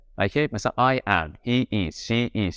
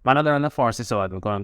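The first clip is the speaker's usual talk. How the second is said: ordinary delivery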